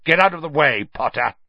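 loud, high, sharp command; angry order. Acted. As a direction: order him aside, patience gone. Snape orders Harry aside angrily.

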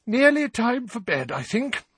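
high, yawny mother's voice; sleepy, high-pitched bedtime hint; yawning. A narrator's reading. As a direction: say it through a stifled yawn. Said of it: "said Missus Weasley with a yawn".

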